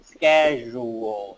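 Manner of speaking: schedule said slowly, syllables pulled apart; split into syllables. Shown as a demonstration. Teacher splitting schedule into syllables, slow. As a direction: break schedule into pieces, slowly. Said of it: "mình sẽ tách ra, ví dụ như này".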